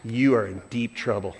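low, soft stern warning; stern scolding. Retold or imitated. Voicing a scolding woman.

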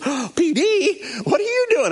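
high, delighted mock astonishment; imitated happy surprise. Dad finding the pastor on the phone, mock surprise. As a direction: jump up in pitch, pleasantly startled.

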